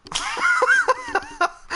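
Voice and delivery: high-pitched burst of laughing; laughter. Real, not acted. Laughing at own hand.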